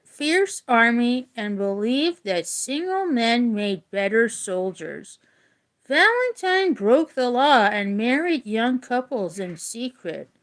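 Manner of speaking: usual speaking voice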